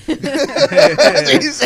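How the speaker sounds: loud, high, drawn-out laughing words; slow words pushed through big laughter. Real, not acted. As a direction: crack up while saying it. Laughing after retelling instructor's joke.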